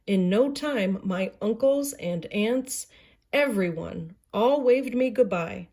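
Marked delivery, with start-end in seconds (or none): none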